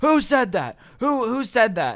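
high, indignant repeated demand; outraged demand. Retold or imitated. Irate customer demands to know who heckled her, high and indignant. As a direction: imitate a customer rounding on a heckler.

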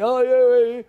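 high, loud mock cheer; acted fan's excited yell; eager volunteer. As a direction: shout back keenly from the crowd. Acting eager fan answering announcer.

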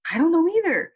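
put-on mother matching her child's dismay; helpless. Mother echoes distressed child's helplessness.

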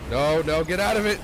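loud, high frantic shout; panicked shout. Real, not acted. Gamer caught by an enemy, frantic loud shout. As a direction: yell to break free.

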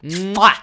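big, high "mwah" smack; loud kiss sound. Pretend. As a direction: blow a loud, exaggerated kiss. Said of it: "it sounded like a moi".

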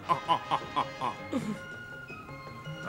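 a hearty chuckle at his own joke. Acted. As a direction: laugh it off after the pun. Host laughs at own joke.

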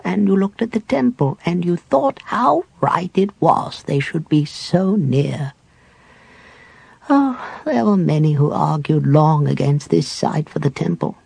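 put-on old cleric at peace with dying; frail dying cleric. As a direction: reminisce gently facing death. Cleric speaks to Tanis.

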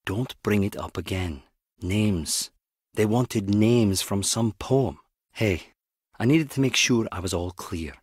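low, softened, guarded voice; quiet and heavy, pitched low; subdued warning. Acted. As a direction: confide it reluctantly, keeping it down. Acted guarded confession, low and soft.

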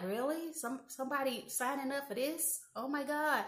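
soft, muttered disbelief; mock it under your breath; sarcastic aside. Daughter answering her mother's boast under her breath, softly sarcastic. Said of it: "And I'm like".

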